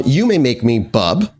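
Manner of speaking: usual speaking voice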